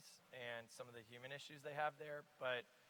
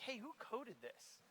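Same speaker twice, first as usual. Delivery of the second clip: higher, slow, a skeptical edge under a friendly question; implicitly doubtful question; 9 semitones higher, 5 dB quieter, slower than usual. Retold or imitated. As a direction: let the doubt leak into an innocent question. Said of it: "implicit in my voice was like".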